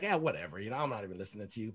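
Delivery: low, soft mock brush-off; retold offhand dismissal; dismissive. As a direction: toss it away quietly, barely bothering. Referee retold brushing off coach.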